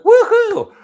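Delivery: a high, loud whoop with a laugh; gleeful cheer. Retold or imitated. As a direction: whoop like you just won something. Cheering over the Diet Coke news.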